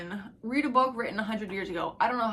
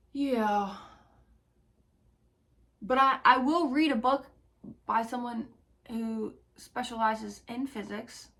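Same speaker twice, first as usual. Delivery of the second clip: normal talking voice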